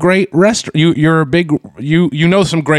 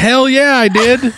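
proud; 9 semitones higher, slower than usual. Real, not acted. Proudly owning the nickname.

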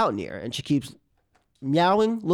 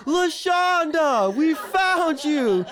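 high, slow, delighted call; much higher happy calling; overjoyed; 12 semitones higher, much slower than usual. Retold or imitated. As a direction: sing out with relief and joy. Overjoyed at finding the cat.

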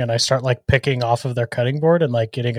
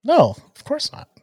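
defensive denial; 13 semitones higher than usual. Acted. Rubo denying oversleeping.

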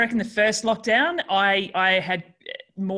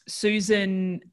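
normal talking voice

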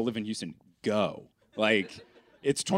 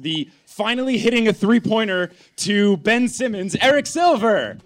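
hype intro; 7 semitones higher, 4 dB louder than usual. Acted. Hyped co-host introduction.

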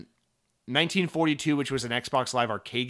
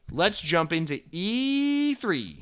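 slow, stretched, announcer-style segue; a hammed-up slow show-host lead-in; drawn-out announcer intro; 5 semitones higher, much slower than usual. Segue into main topic.

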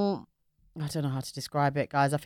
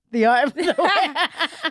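announcer voice; 9 semitones higher, 5 dB louder, slower than usual. Acted. Show segment title.

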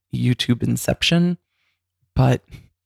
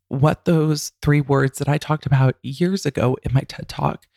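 usual speaking voice

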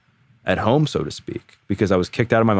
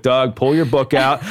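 higher, slower teasing reminder; teasing friends; 6 semitones higher than usual. Retold or imitated. Friends teasing him mid panic attack, higher and slow. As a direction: tease him like his buddies did. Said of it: "my friends would be like".

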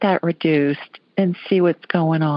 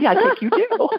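high-pitched, amused retold reply; tickled agreement, retelling her own comeback; laughing retort; 13 semitones higher than usual.

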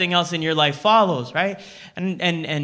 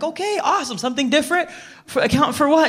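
high, puzzled questioning; confused by her answer, pitch way up; baffled; 7 semitones higher, faster than usual. Retold or imitated. To the accountant girl, baffled and high.

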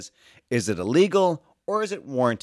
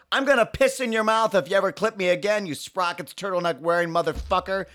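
high-pitched furious threat; mock furious threat; 9 semitones higher than usual. Pedestrian threatening the bike rider, high hammed-up fury. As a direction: spit it at the cyclist.

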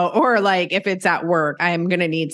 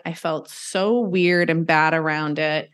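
usual speaking voice

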